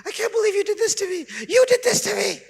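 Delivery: sister's hammed-up crying protest at being corrected; melodramatic sister. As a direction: wail it like an overdramatic sister. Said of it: "And she says".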